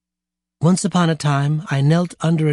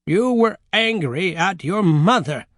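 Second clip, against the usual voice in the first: higher, slow, deliberate verdict; matter-of-fact grandmother; 4 semitones higher than usual. Acted. Grandmother concluding for the children, matter-of-fact. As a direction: state it slowly as a settled fact. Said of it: "the grandmother concluded matter-of-factly".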